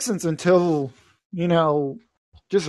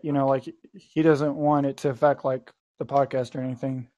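ordinary talk, nothing marked

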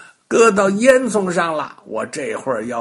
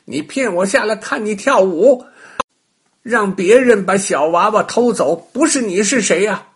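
louder, accusing witch; angry witch; 6 semitones higher, 6 dB louder than usual. A narrator's reading. Witch accuses the rabbit, loud and angry. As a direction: jab each accusation. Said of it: "跳着叫道".

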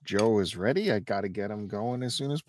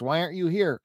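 mocking text voice; 8 semitones higher, faster than usual. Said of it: "these are actual texts".

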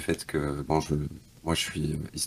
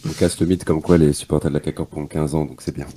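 low and velvety, unhurried; deep smooth voice; 8 semitones lower than usual. Real, not acted. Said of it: "une voix grave et suave".

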